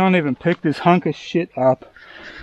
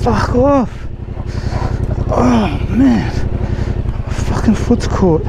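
frustrated yelling; 7 semitones higher, much slower than usual. Real, not acted. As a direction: yell it out in frustration. Foot caught, frustrated shouting.

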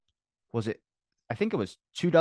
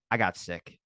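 low, subdued little admission; disappointed child; 5 semitones lower, 4 dB quieter than usual. Retold or imitated. Uncle imitating his upset little nephew, mildly disappointed. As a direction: imitate a let-down kid, slightly softly. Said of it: "disappointed in himself".

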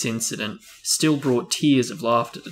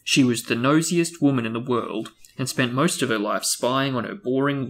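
ordinary talk

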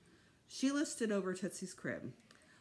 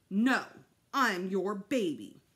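loud, slow, emphatic words; stubborn child refusing correction; insistent protest; 8 dB louder, slower than usual. A narrator's reading. Boy contradicting his mother, insistent protest.

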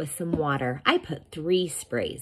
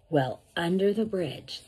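usual speaking voice

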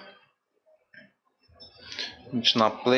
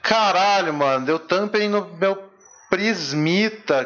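outraged outburst; 7 semitones higher than usual. Real, not acted. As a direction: blurt it out in frustrated disbelief. Card got tampered, outburst.

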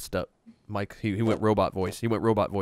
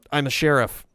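Chuck Norris; 5 semitones higher, 6 dB louder than usual. Pretend. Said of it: "So say a Chuck Norris".

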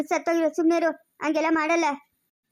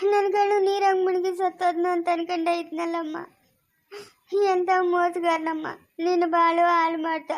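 crying. Acted. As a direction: grieve aloud over ruined lives. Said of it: "ಯಾಕ್ ಅಳ್ತೀರಾ?". (ಅಳುತ್ತಾ ದುಃಖ ತೋಡಿಕೊಳ್ಳುವುದು)

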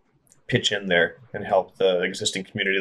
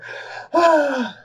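exhausted exhale. Real, not acted. Exhale after one-breath list.

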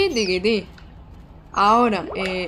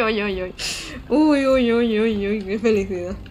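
normal talking voice

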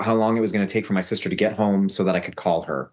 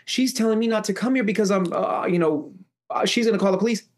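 high-pitched mock-tearful whine; put-on sobby complaint, pitched way up; fake crying; 10 semitones higher than usual. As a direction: imitate someone faking tears, high and pleading. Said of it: "fake crying".

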